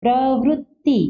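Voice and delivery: प्रवृत्ति with a clear प्र and वृ, double त्ति; careful pronunciation. Shown as a demonstration. Teacher shows students how to say प्रवृत्ति correctly. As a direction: spell out प्रवृत्ति sound by sound. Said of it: "यहाँ प्र है और ये वृ हो गया".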